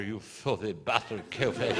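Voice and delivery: sudden low, quiet curse; priest swearing. Retold or imitated. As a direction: drop it low and soft like a grumbling priest. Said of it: "That's the way they talk".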